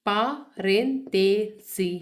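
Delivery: parentesi stretched very slowly, every syllable held; teacher's slow model word; slow drilled word. Shown as a demonstration. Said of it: "slow drilled model word".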